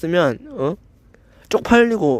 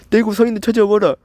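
mocking the commenter; 5 semitones higher than usual. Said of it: "이렇게 하는 거 아니야?".

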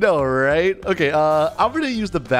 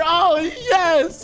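gleeful cheer; 11 semitones higher than usual. Real, not acted. Bully gets grounded, speaker cheers.

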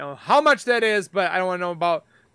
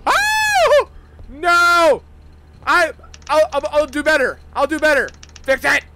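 high, louder frightened begging; frightened pleading; 7 semitones higher, 6 dB louder than usual. Real, not acted. Gamer begs the game in fear, high and louder. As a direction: plead not to be sent down. Begging after being sent down.

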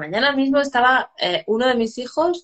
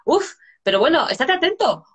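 much higher, fed-up parent telling off; exasperated scolding; 6 semitones higher than usual. Retold or imitated. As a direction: scold the clumsy kid with a sigh. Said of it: "la mayor reacción es decir". (regañina exasperada, mucho más aguda)

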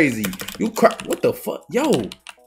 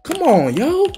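ordinary talk

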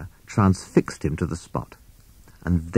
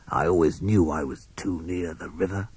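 softer, hissed snake voice; softer sibilant snake grumbling; hissing snake; 6 dB quieter than usual. A narrator's reading. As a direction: hiss it out like a sly snake. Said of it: "hissed Sid".

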